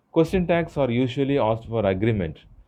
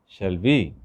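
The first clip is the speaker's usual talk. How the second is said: "we" pitched up as a real question; rising intonation on "Shall we?"; 2 semitones lower, 4 dB louder than usual. Shown as a demonstration. Teacher showing students a real question, rising intonation on "we". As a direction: lift the tone on "we". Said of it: "What is rising intonation?".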